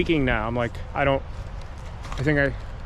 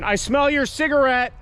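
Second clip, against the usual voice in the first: calling out; 10 semitones higher, slower than usual. Real, not acted. Calling out to hidden figures.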